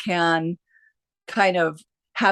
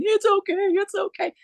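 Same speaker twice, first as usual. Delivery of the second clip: much higher imitated sobbing repeat; sobbing reassurance. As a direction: replay her choking out the words. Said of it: "she's sobbing".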